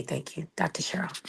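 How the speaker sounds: soft unvoiced thanks; whisper. Real, not acted.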